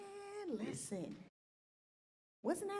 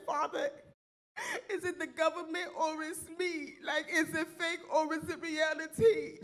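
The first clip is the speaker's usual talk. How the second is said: mock prayer to the Lord; 6 semitones higher than usual. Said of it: "I said".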